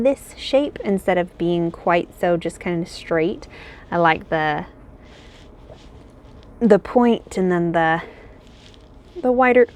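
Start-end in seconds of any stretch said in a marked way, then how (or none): 3.9-8.0 speaking through hiccups; hiccuping mid-sentence. Real, not acted. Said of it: "I've got the hiccups". 9.2-9.7 a hiccup breaks the words; hiccuping while talking. Real, not acted. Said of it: "I've got the hiccups".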